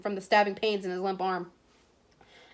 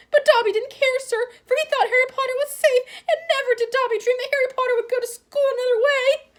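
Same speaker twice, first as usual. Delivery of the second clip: high, squeaky, loud elf voice near tears; tearful house elf; 5 dB louder than usual. A narrator's reading. House elf reproaching Harry, squeaky and tearful. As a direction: plead like a weeping servant elf.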